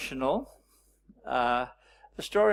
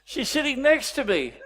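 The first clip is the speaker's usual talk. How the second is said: put-on high, loud indignant outburst as a child; child's loud protest; 11 semitones higher, 9 dB louder than usual. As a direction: blurt it out like an outraged seven-year-old. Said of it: "I jumped up and I said".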